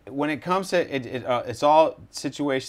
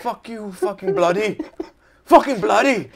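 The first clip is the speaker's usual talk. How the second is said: high mock-angry cursing, building louder; mock non-native cusser; 9 semitones higher, 8 dB louder than usual. Host mimicking people cussing at each other, loud mock cussing. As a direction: imitate a riled foreign swearer, pitched way up. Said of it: "people who don't speak English good fucking cuss at each other".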